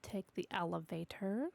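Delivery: ordinary talk, nothing marked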